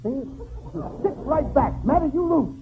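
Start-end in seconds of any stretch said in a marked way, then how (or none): none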